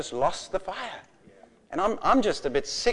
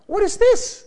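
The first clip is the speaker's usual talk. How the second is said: put-on high, loud astonished child voice; wide-eyed kids; 6 dB louder than usual. Preacher mimicking children staring at him, wide-eyed and amazed. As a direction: squeal it like a kid with eyes like saucers. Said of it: "their eyes were like saucers".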